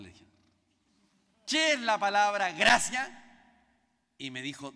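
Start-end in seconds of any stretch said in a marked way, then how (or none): 1.5-3.2 loud, slow, pressing question; insistent question. Retold or imitated. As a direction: pin her down with the question, slowly and loudly.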